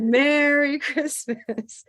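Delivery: high, slow, drawn-out Santa call. Shown as a demonstration. Teacher joking with a student about dressing as Santa, high drawn-out Santa greeting. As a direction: play a hammed-up Santa, stretching the greeting. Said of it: "maybe if they're dressed as Santa".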